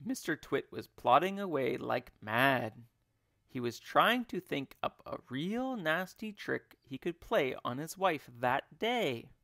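ordinary delivery, nothing marked

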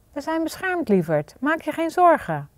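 hushed motherly calming; gentle reassurance. A narrator's reading. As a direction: comfort a scared child tenderly. Mother reassures frightened daughter.

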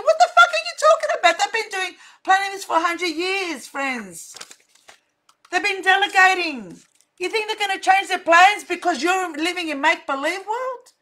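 furious outburst at listeners' naivety; exploding in anger. Retold or imitated. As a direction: let your head blow up at them. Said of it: "my head fucking blows up".

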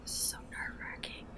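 nervous hushed whisper. Real, not acted. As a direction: breathe the words, scared. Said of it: "Why are you whispering?".

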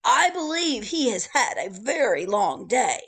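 loud, high, cheery bellhop welcome; chirpy old-fashioned bellhop. Acted. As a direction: call out the welcome like a grand hotel porter. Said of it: "said the bellhop".

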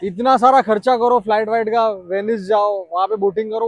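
ordinary delivery

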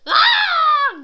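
high loud shriek with laughter; tickled laughing scream. Real, not acted. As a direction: shriek while being tickled.

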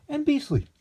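high, loud final word of the list; indignant peak. A narrator's reading. Lexington quoting his aunt's disgust, peaking loudly. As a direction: spit out the last of the insults. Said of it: "climax of disgust list".

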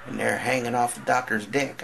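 usual speaking voice

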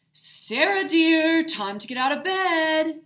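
a dad calling out loud and high to wake his daughter; sing-song call up the stairs to get her out of bed; dad calling upstairs. A narrator's reading. Dad wakes Sarah for school.

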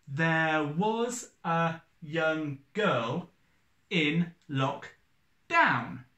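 very slow, each syllable spaced out; syllable by syllable, unhurried; counting syllables. Shown as a demonstration. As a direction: tap out every syllable slowly. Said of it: "Eight syllables".

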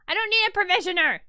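loud, fast, exasperated protest; indignant rant at voting viewers; annoyed outburst. Real, not acted. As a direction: fling it at chat in frustration. Outburst at chat's vote.

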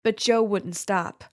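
plain reading voice

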